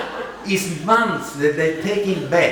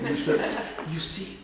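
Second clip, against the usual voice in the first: normal talking voice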